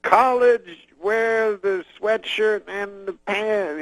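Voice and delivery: high, slow, drawn-out comic advice; Bill Cosby impression. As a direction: stretch out broken phrases high in the voice. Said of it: "Bill Cosby goes".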